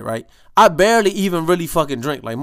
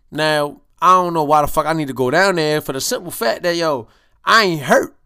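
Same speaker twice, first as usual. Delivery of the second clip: ordinary talk, nothing marked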